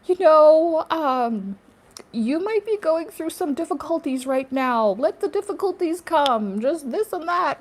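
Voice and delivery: high, loud, soothing guru voice mocking calm; mock spiritual teacher. Mocking spiritual teachers on YouTube, high soothing voice. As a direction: imitate a strained teacher keeping it together. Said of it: "they're just like".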